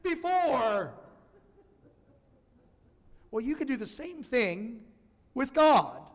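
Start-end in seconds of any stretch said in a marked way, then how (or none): 0.0-0.9 high, slow incredulous question; wry disbelief. Retold or imitated. Owner to her suddenly brave dog, high and incredulous. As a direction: ask it in amused disbelief. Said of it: "And I'm like".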